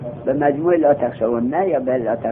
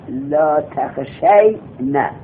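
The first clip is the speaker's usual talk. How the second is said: slow dictation; much slower than usual. Shown as a demonstration. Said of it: "slow dictation".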